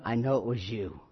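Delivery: low, soft, menacing mobster murmur; Godfather-style hushed threat; Michael Corleone. Retold or imitated. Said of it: "And he tells him".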